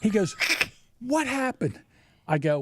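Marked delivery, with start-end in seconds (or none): 1.0-1.8 raised-pitch imitation of a demanding dad. Dad questioning his injured son, high demanding question.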